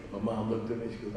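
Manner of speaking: usual speaking voice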